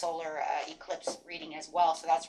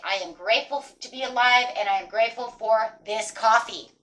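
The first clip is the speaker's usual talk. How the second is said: a louder, beaming morning affirmation; cheerful affirmation; 3 semitones higher, 7 dB louder than usual. Shown as a demonstration. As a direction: greet the day with gratitude. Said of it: "every morning and say".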